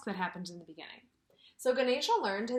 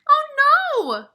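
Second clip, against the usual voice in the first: high, loud squeaky yell; mouse yell; 16 dB louder than usual. Acted. Mister Mouse seeing the broken tusk, high alarmed yell. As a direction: cry out in alarm like a small mouse. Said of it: "Mister Mouse yelled".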